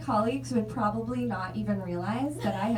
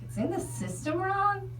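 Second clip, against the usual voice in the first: higher "It's in the system wrong?" with a rising question on "wrong"; incredulous echo; 8 semitones higher than usual. Retold or imitated. Repeating the nurse's excuse back to her, incredulous.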